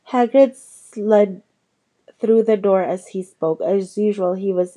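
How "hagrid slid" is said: ordinary delivery, nothing marked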